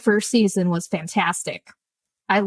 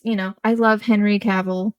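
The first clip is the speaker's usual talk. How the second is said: ordinary delivery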